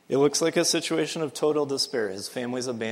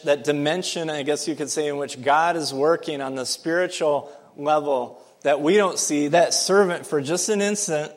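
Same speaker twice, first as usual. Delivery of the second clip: preaching at full force; 2 semitones higher, 6 dB louder than usual. Acted. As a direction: build the sermon to a ringing peak. Preaching climax rises.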